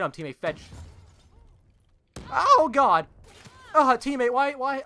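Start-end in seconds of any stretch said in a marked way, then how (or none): 2.3-3.1 loud, high cry of alarm; startled yell. Real, not acted. Startled during game fight. 3.7-4.8 loud, high-pitched complaint; exasperated yell. Real, not acted. Teammate failed to flank.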